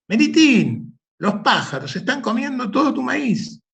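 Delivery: llamada aguda y algo más fuerte; grito de aviso. Acted. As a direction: avisa a gritos desde el camino. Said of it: "alguien le gritó". (alguien gritándole a Melitín, agudo y fuerte)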